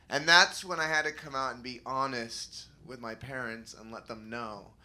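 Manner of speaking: ordinary talk, nothing marked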